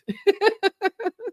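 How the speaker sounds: high, sustained giggling laugh; real laugh. Real, not acted. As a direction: laugh helplessly at the joke. Laughs at own joke.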